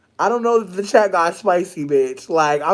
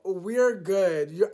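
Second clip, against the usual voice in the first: normal talking voice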